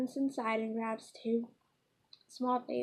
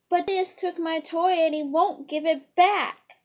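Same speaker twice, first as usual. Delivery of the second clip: put-on loud, higher tattling kid voice; loud whiny child; 5 semitones higher, 9 dB louder than usual. Little prince tattles to his mother about the toy, loud and whiny. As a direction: complain loudly like a wronged little boy.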